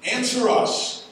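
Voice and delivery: loud, high desperate cry to a silent god. Acted. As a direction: howl it up to the heavens, slowing at the end.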